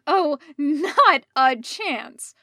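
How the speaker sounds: loud, slow flat refusal; interrupting. A narrator's reading. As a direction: cut him off, firmly. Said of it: "Rodney interrupted".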